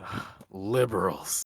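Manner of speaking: low, slow, sighed-out complaint; imitating a fed-up man's soft grumble; disgusted sigh. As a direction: sigh it out slowly, seeking agreement. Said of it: "He kinda gestures to me and he says".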